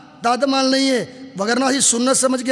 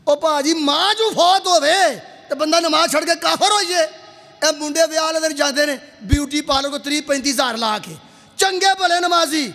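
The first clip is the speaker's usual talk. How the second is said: raised, higher-pitched scolding; heated preaching; 6 semitones higher, 3 dB louder than usual. Real, not acted. Preacher scolding worshippers who skip prayer, heated. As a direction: take the congregation to task, voice pitched up.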